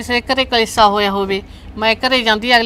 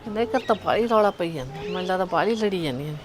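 hushed gossip; 8 dB quieter than usual. Acted. Neighbour eavesdropping on a quarrel.

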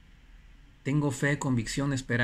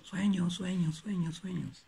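higher, dying echo of sueños; fading echo; 5 semitones higher, 5 dB quieter than usual. Retold or imitated. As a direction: let each sueños fade away. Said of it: "Así decía ... el eco".

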